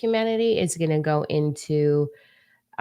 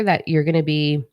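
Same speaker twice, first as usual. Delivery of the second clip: ordinary talk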